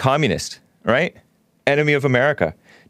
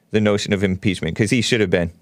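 ordinary talk, nothing marked

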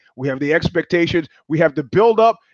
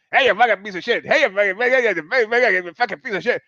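foul-mouthed squawking parrot, rapid and repetitive; screaming parrot; 3 semitones higher than usual. Acted. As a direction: squawk curses like a sailor's parrot. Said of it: "The parrot starts screaming at people".

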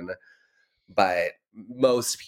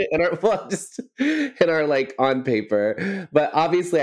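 laughing; 8 semitones higher, 3 dB louder than usual. Real, not acted. Laughing at own label.

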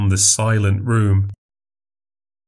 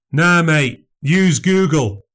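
heckler taunt; 7 semitones higher, slower than usual. Acted.

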